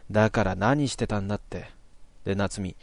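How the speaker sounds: ordinary talk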